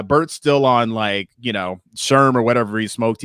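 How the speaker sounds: ordinary delivery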